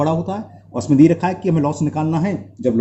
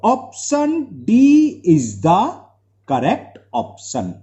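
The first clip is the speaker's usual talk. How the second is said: slow announcement; 3 semitones higher, much slower than usual. Real, not acted. Teacher announces answer slowly.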